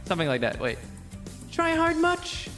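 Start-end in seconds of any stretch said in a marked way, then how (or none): 1.5-2.5 very high echoing spirit voice. Shown as a demonstration. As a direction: sneer it from far off. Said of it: "there we go".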